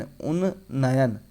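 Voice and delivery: उन्नयन drawn out slowly in parts, un-na-yan; syllable by syllable. Shown as a demonstration. Teacher spelling out उन्नयन for students, slow syllable-by-syllable. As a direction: stretch उन्नयन out slowly so each syllable shows. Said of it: "उन्नयन की spelling जो है क्या है?".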